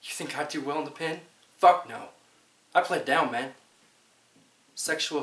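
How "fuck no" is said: sudden loud outburst. Acted. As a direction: spit it out loud. Emphatic denial in monologue.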